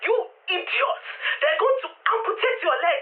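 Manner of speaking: very high, wailing dad impression; scolding dad. Dad on the phone about her leg, high frantic scolding. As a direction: imitate a dad's high-pitched, frantic telling-off. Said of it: "Let me speak to her".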